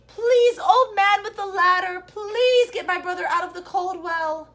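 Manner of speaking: high, loud frightened plea; frightened boy begging the old man; pleading child. A narrator's reading. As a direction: beg the old man urgently. Chang begs old man.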